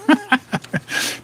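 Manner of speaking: soft, high chuckle. Real, not acted. Host joking about wife's shopping, soft chuckle. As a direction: laugh softly at your own joke. Laughs at own money joke.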